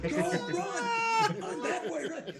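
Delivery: very high, frantic cheering; little league parents. Acted. Parents urging a confused little leaguer on, high frantic cries. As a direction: squeal urgent directions from the stands. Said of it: "the parents are like".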